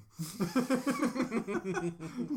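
loud burst of laughter, rising high; big laugh. Real, not acted. Cohost cracking up at a silly name, loud laugh.

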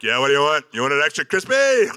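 high, loud barking counter guy; gruff fast food guy. Retold or imitated. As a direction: bellow it like a rough KFC cook. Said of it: "he's like".

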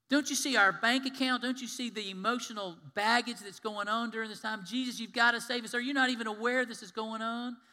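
loud, high pleading shout; desperate cry. Retold or imitated. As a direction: cry out for rescue. Congregation's inner cry.

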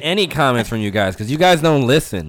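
shouting at the listeners; loud. Real, not acted. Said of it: "Sorry if I'm too loud".

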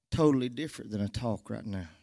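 ordinary delivery

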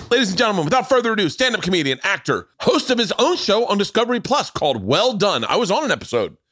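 high, hyped host introduction; announcer intro. Acted. Host introducing his guest to listeners, announcer hype. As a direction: build up the guest like a ring announcer.

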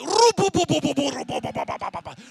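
high, booming rolled-syllable babble; hammed-up, very loud prayer gibberish; imitating speaking in tongues. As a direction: blast out mock tongues to be heard through walls. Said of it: "Speaking in tongues".

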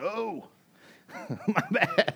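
high-pitched sheepish mock apology, laughing; embarrassed intruder. Latecomer walking in behind the preacher, mock embarrassed. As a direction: squeak out a comic apology.

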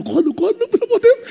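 the frantic mind begging you not to. Acted. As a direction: pitch shoots way up into a pleading squeal. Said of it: "tua mente falando".

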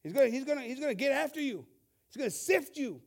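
God's voice thundered from the pulpit; fiery preaching. Acted. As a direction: press the church hard, building. Preaching at full force.

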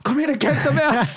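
high, laughing come-in call; eager call. Retold or imitated. Nerdy boys waving the new kid into their hideout, high laughing call. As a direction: call them in like a secret club. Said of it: "åbner døren sådan".

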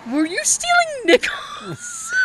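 very high, slow mock shriek; scandalized mom. Mom confronting dad over pocketed change, scandalized. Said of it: "My mom slammed her hand on the table and went".

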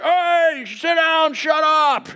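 high-pitched yell of a bus driver; acted yelling. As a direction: holler at rowdy kids, hammed up. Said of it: "back in the day when people yelled".

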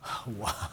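long soft sigh; quiet sigh. Acted. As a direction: let out a deflated sigh. Pause after Jesus' words.

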